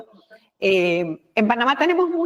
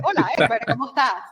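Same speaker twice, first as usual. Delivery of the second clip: mock affected greeting, pitched higher; put-on greeting voice; 3 semitones higher, faster than usual. Shown as a demonstration. To show listeners how they shouldn't talk, a put-on higher greeting. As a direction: fake a stiff, unnatural hello. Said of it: "Tú no dices".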